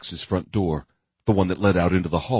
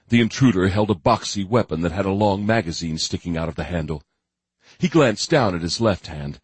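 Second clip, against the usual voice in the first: ordinary delivery, nothing marked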